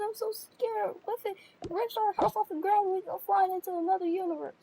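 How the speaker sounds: high, panicky wailing; hammed-up despair about the hurricane; overdramatic fear. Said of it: "You're just being too dramatic".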